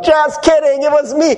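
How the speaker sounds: high, lightly hammed-up gotcha; mock prankster parent. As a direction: tease like a parent revealing the prank. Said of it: "the parent comes down and they're like".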